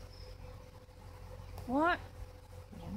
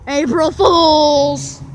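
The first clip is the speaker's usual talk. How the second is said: loud drawn-out prank yell; scream into giggles; 3 semitones lower, 9 dB louder, much slower than usual. Pretend. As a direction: shout it slow to startle. Prank yell, friend scared.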